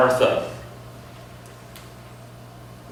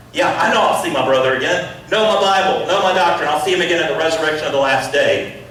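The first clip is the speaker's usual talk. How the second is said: defensive Martha; 3 semitones higher, 5 dB louder, faster than usual. Acted. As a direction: snap back with what you know. Martha retorting to Jesus.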